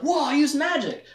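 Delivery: high, excited exclamation; amazed. Acted. Richard throwing fire with magic, high amazed excitement. Acted first use of magic.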